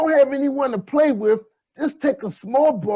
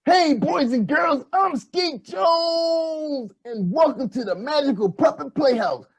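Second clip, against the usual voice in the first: put-on slow, stretched kids TV host; drawn out with a shout on Skeet Jones; kids show host voice; 3 semitones higher, slower than usual. Puppet host intro, retake.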